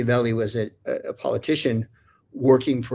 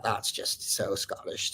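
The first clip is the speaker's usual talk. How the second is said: whispered thought; 2 semitones lower, 9 dB quieter, slower than usual. Retold or imitated. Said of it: "I thought".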